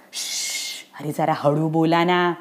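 low, soft shushing; nervous guard keeping it down; hushing. Acted. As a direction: shush them and speak under your voice. Doorkeeper hushes the noisy children.

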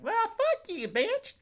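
very high, singsong insult; mock sweet retort. Library visitor's imagined retort to a rude man, mock sweet. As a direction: squeak the comeback in a put-on voice.

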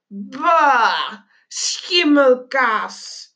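slow, drawn-out revulsion; dragged-out yuck; disgusted. A narrator's reading. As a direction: spit out the taste with distaste. Disgust at tasting the mousse.

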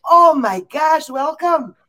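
high, loud mock-Irish welcome with a stretched opening; hammed-up Irish accent, sliding toward Scottish; bad Irish accent. Cohost playing a visitor from Ireland, bad Irish accent. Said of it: "do a really bad accent".

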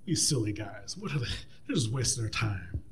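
low, soft dismissive aside; dismissive mockery. Tobiah writing off the builders, low mocking aside. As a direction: drop low and wave them off. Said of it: "He's like".

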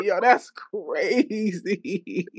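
slow, stretched-out disbelief; stunned drawl. Retold or imitated. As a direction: drag it out in disbelief. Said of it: "I'm like".

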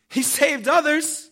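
loud, high, drawn-out scoff. Acted. Rulers jeering at Jesus on the cross, scoffing. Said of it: "the rulers scoffed at him".

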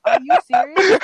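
high, loud, laughing through the words; laughing disbelief. Retold or imitated. Retelling her reply to teacher.

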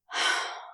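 breathy acted sigh. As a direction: let out a short, voiceless sigh. Crowley's reaction in the story.

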